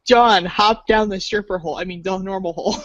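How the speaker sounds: ordinary talk, nothing marked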